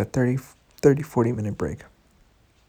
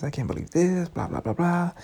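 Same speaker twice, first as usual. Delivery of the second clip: higher, whiny mock rant; mocking her complaint; 7 semitones higher than usual. Mocking the upset girl's rant, high and whiny. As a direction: parody her nagging, pitch up. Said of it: "She's giving me her fucking shit".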